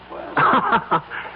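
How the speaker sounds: short mocking laugh, high and breathy; scornful breathy laugh. [name] scoffing at Bailey's conscience, a mocking laugh.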